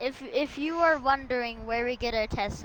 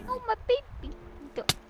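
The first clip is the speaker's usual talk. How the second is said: high doting cry; 12 semitones higher, 4 dB quieter than usual. Pretend. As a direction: squeal with high pity. Fretting over dog.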